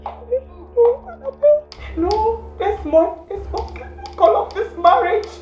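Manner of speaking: distraught pleading. Acted. Distraught woman pleading loudly.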